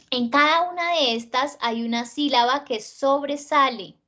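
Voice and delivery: usual speaking voice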